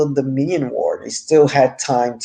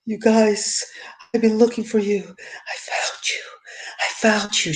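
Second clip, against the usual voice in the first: higher, whispered teary imitation; mock teary whisper; 7 semitones higher, 4 dB quieter than usual. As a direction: parody her tearful reunion. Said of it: "with those teary eyes".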